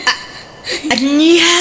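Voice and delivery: startled outcry; shocked. Acted. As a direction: jolt with disbelief. Aunt shocked at confession.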